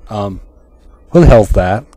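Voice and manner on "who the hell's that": loud startled outburst. Acted. Startled at intruder on stage.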